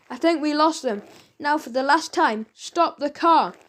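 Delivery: ordinary delivery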